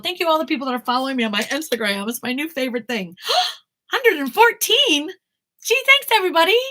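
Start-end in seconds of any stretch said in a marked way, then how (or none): 3.5-6.6 high, loud excited thanks; gasp, then gush thanks in a high, loud voice; thrilled squeal of gratitude; delighted surprise. Real, not acted. Delighted by new follower count.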